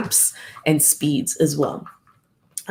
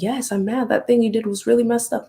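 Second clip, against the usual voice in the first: mock-mad retort, pitched higher; 6 semitones higher than usual. Upset person answers a child's "are you mad?", mock mad. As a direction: imitate someone mad, higher but not shouting. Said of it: "Are you mad?".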